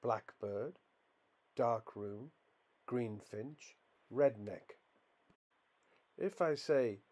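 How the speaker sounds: ordinary delivery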